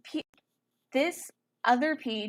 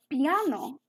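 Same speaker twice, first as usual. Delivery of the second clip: slow, drawn-out piano with a clumsy Italian accent; attempted Italian accent; 5 semitones higher, 4 dB louder than usual. Shown as a demonstration. Teacher showing students the music term, attempted Italian accent. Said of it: "sorry, horrible Italian".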